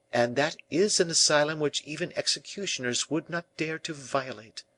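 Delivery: high, energetic pleading; passionate La Valliere. A narrator's reading. La Valliere pleads for Fouquet, passionate and energetic. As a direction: argue fervently in a high voice. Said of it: "the passionate energy of her voice".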